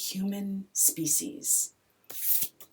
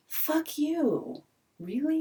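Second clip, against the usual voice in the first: defiant retort; 9 semitones higher, slower than usual. Real, not acted. Rejecting the at-risk label.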